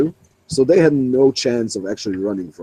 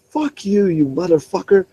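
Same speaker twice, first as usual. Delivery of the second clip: higher-pitched furious rant of a sick cop; imitated angry cop; 7 semitones higher than usual. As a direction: rage at the kid who made you run. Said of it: "he was so angry".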